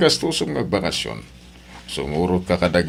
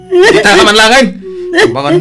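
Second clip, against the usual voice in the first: much louder, higher yell; angry shout; 12 dB louder than usual. Acted. Husband losing patience with wife, shouting. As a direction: bellow at her.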